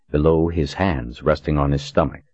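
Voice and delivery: ordinary delivery, nothing marked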